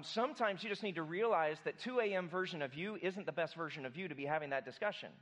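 ordinary talk, nothing marked

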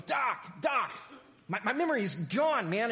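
a man near tears who can't remember anything; panicked patient. Acted. Agitated patient in joke.

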